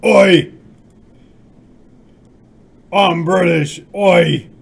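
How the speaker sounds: very loud, hammed-up fake British; bad British accent. Streamer's worsening attempt at British for chat, shouted bad accent. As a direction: bellow it in a badly faked British accent. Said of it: "admittedly got worse".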